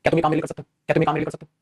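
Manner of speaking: ordinary talk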